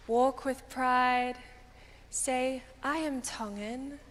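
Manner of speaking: ordinary talk, nothing marked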